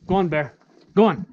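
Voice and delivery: loud, firm commands to a dog. Real, not acted. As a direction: order the dog forward, then praise. Commanding dog past rails.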